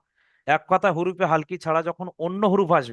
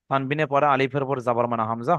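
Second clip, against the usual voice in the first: usual speaking voice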